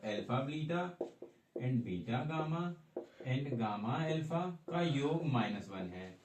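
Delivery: very slow, deliberate dictation. Shown as a demonstration. Teacher slowly dictates the given sums to the class. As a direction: spell it out so everyone can copy.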